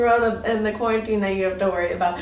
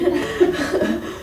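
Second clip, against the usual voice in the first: laugh; 9 semitones higher, 5 dB louder than usual. Real, not acted. S2 laughs at her own story.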